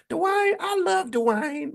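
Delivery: high, slow, drawn-out lovesick croon; Whitley. Acted. Host imitating sitcom character pining for Dwayne, high drawl. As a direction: stretch the vowels in a very high imitation. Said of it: "remember how she talked".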